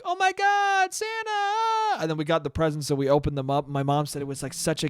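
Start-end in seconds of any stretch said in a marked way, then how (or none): 0.0-2.0 put-on squealing high with kid delight; much higher, thrilled child squeal; excited kids. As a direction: shriek it like kids spotting Santa. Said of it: "we're all like".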